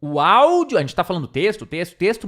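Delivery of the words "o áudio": O áudio punched, louder; repeated for emphasis. Shown as a demonstration. Teacher repeating a point so students take note, hit áudio. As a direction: lean hard on áudio. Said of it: "Vou falar de novo".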